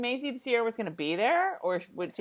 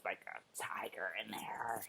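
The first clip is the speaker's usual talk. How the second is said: hushed whisper, almost no voice; 10 semitones lower, 15 dB quieter than usual. Real, not acted. Whispering to a friend about the secret wild side. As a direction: let the tiger line slip out under your breath.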